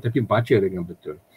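ordinary delivery

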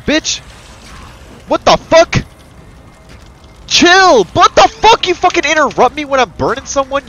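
high, loud outraged shouts; furious yelling. Real, not acted. Player yelling at an enemy who interrupted him, furious shouts. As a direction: yell in real fury.

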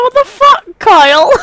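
high-pitched, loud mock outrage; outraged shout. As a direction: yell it in shrill disbelief at Kyle. Acted outraged reaction to the game.

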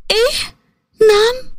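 stunned, can't believe her ears; shocked disbelief. Acted. Daughter hearing father's insulting offer, shocked high cry. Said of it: "هدى هنا اتصعقت باللي بيقوله".

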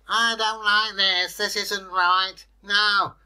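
high, loud, slow whine of protest; a put-upon water creature wailing slowly; high-pitched creature complaint; complaining Kelpie. A narrator's reading. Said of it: "said the Kelpie".

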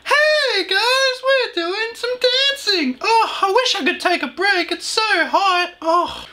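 high-pitched, drawn-out mock performance; hammed-up parody of a children's presenter; overacted kids' host. Reactor mocking the video's dancing intro, high overacted host voice. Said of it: "I just love the overacting".

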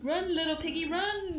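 high, loud urgent call; urgent shout. Acted. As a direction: yell at him to run. Calling out to the game pig.

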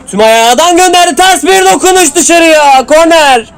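rising up as the shot flies out; excited call. Real, not acted. Commentator calling a shot going wide, excited. Chance goes just wide.

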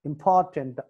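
Important with a strong "por"; important, stressed puffed P, falling end. Shown as a demonstration. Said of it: "పి దగ్గర stress mark".

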